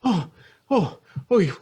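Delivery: high-pitched, stammering confusion; flustered Beth. Acted. Beth answers Roy's radio call, flustered. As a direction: stumble over the questions, high.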